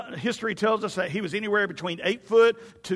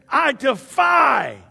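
loud, high, long-drawn challenge; trash-talking defiance; 6 semitones higher, 7 dB louder, much slower than usual. Retold or imitated. Goliath defying the ranks of Israel, drawn-out mock bellow. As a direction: bellow it out, stretching the last word. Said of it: "he would trash talk".

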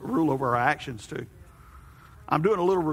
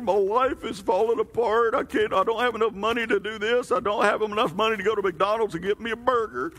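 whiny complainer; 6 semitones higher than usual. Acted. Voicing a complaining person.

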